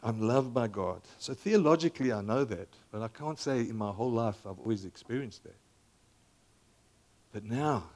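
usual speaking voice

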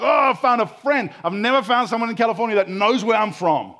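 high, loud burst of delight; excited exclamation. Acted. Spanish surfer thrilled someone knows his homeland, excited outburst. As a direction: gush as if you've finally found a friend. Acts out delighted new friend.

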